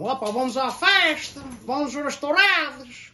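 put-on playful Terceira accent teasing the family at home; joking Terceira accent. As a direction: ham up a Terceira islander accent for laughs. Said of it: "I had always done that accent as a joke".

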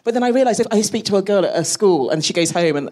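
normal talking voice

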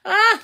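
a sudden yelp of alarm; startled yelp. Real, not acted. Painter yelps at a paint mistake, high and loud. Brush mishap while painting.

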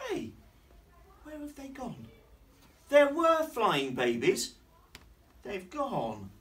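acted little girl's high-pitched protest; puzzled child. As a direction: begin small and high, then insist. Lulu searches for vanished babies.

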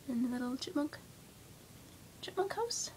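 soft whisper, rising at the end. Real, not acted. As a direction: point at the picture in a hush. Whispering while pointing at pictures.

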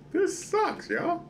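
very high-pitched whine; whiny complaint. Real, not acted. Gamer complaining to viewers about the game, whiny. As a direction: squeal your frustration to the audience.

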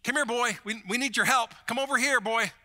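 high, loud mock call as if summoning a dog; hammed-up summoning, high and loud. Pastor mocking the crowd calling Jesus over, loud high mock call. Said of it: "it was like this".

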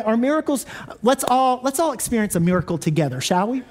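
ordinary talk

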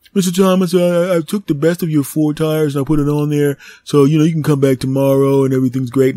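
high, loud, eager clerk voice; tire shop clerk. Retold or imitated. Tire shop clerk reporting to Mister Thomas, high and eager. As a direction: play the cheerful counter guy.

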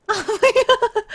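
real amused laugh. Real, not acted. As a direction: gasp it out mid-laugh. Laughs on finding wrong place.

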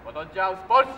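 drill command. Real, not acted.

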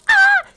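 a high, loud sung belt like [name]; soaring gospel-style wail in [name]'s manner. Retold or imitated. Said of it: "[name] voice".

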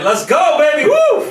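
loud, high hype yell; excited shout. Real, not acted. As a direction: whoop it up with real joy. Guest hyped by intro.

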